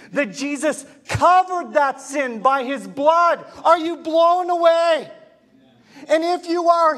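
high, loud preaching climax; preaching at full force. Acted. Pastor rousing the church about sin, full-force preaching. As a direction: lift the room, preach it hard and loud.

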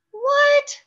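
high squeaky startled what; surprised what. Acted. Surprised reader voicing Charlie.

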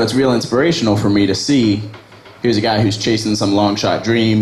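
normal talking voice

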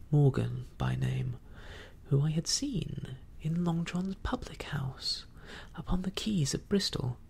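ordinary delivery

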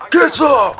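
very high, loud shouting; squealing high-pitched war cry; battle taunt shout. Real, not acted. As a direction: shriek taunts at the enemy mid-fight. Taunting enemies mid-fight.

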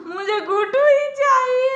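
fake-cry the plea to win her back; mock crying. Husband begging angry wife, hammed-up mock sob.

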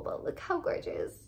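hushed whisper over the shoes; awed whisper. Real, not acted. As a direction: whisper it, admiring. Admiring the shoes softly.

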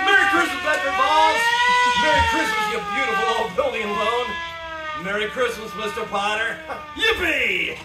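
high, loud, joyful calls; jubilant shouting. Acted. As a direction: shout your joy to the whole town. George runs through town rejoicing.

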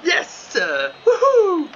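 high, loud cheering yell; joyful whoop. Real, not acted. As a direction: whoop with real joy. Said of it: "j'ai le droit de crier un peu". (cri de joie aigu et fort)

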